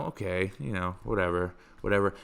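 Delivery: low, soft, unbothered brush-off; dismissive shrug. Acted. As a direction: wave it away under your voice. Acts listener shrugging off first story.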